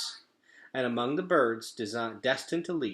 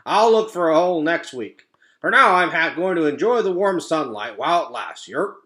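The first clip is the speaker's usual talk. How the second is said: high, loud croaky frog voice; croaking frog; 7 semitones higher, 13 dB louder than usual. Acted. Frog croaks to another frog, high and loud. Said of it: "croaked the first frog".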